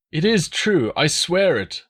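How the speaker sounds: high, frightened begging prisoner voice; pleading deserter. A narrator's reading. Prisoner begging the Roman commanders, high and frightened.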